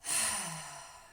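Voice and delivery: audible sigh of release; exhaling sigh. Shown as a demonstration. Guide showing the last release, exhaling sigh. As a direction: sigh the breath out. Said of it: "releasing through the exhale".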